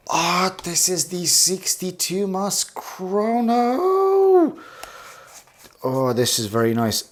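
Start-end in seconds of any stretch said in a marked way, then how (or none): 0.1-4.6 high, squeaky and drawn out. Real, not acted. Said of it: "that was a very, very squeaky voice".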